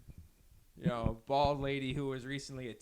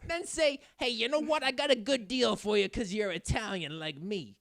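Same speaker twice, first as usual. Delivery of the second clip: sleazy salesman; 7 semitones higher, 3 dB louder than usual. Retold or imitated. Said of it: "sales is so fucked how it's just like".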